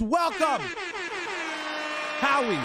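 hyped welcome. Real, not acted. Hyped greeting shout-out to new viewer.

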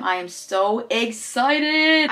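slow, stretched-out excitement; excited. Real, not acted. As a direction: draw the words out with glee. Excited about gifted soap.

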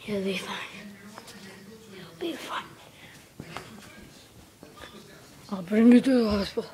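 hushed, low tender words to an injured friend; soothing murmur. Pretend. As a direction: comfort him gently, almost whispering. Comforting hurt Mario.

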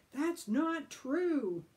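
loud, high, very slow yell. Acted. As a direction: yell it back. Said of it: "dripping and yelling".

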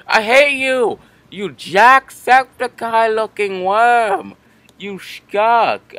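furious high shout at the worm; angry yelling. Real, not acted. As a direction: yell it with hate. Raging at rival worm in game.

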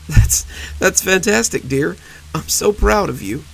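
forcing pride through tears; holding back tears. Acted. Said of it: "slight wince at the end".

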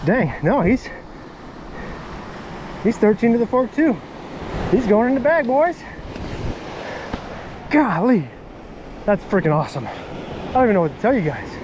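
ordinary talk, nothing marked

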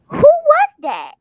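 high loud slow demand; outraged shriek. Acted. Girlfriend outraged at caller.

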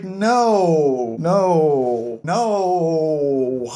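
long, drawn-out wailed no; dismayed protest. Acted. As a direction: groan out each no in mock despair.

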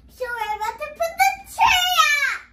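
loud excited yelling. Real, not acted. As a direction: shout it out with excitement. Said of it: "You don't have to yell".

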